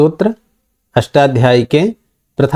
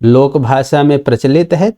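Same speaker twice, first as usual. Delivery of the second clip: ordinary talk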